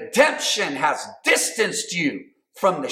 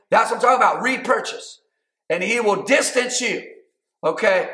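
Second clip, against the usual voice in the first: normal talking voice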